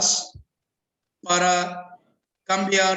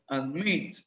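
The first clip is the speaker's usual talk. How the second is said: Admit, lower, weight on "-mit"; stress on last syllable; 4 semitones lower, 4 dB quieter than usual. Shown as a demonstration. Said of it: "la fuerza lo lleva en la última".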